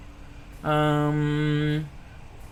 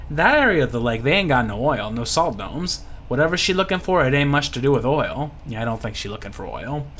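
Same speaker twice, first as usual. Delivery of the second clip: put-on Louisiana-accented local gossip; folksy Louisiana pirate. Lucky explaining Thomas to the party, folksy Louisiana voice. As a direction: fill them in like a bayou insider.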